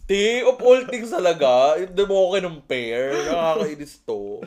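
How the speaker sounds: a touch of mock hurt, said loud; imitating an offended teacher. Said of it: "tas finlash ka".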